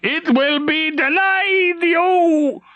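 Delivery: very high, slow, crying rage; furious shout. Acted. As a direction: spit a raging curse at the captain. Traitor raging at the captain.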